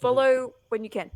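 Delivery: loud call to companions; yelling back. Acted. Player tells companions to follow, yelling back. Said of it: "yell back to Fëanor and Theodric".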